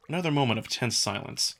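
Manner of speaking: ordinary delivery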